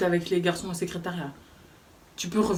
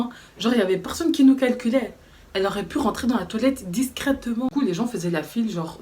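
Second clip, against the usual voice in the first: normal talking voice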